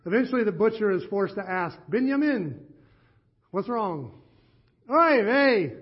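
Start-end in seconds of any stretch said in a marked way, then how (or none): 1.9-4.2 a Yiddish lilt on the butcher's question; Yiddish accent. Acted. Pastor voices the butcher in a Yiddish accent. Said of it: "they begged me not to do the accent". 4.9-5.7 put-on a loud, high Yiddish-accented "Oy vey" lament. Sad villager sighs to the butcher in a Yiddish accent. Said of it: "they begged me not to do the accent".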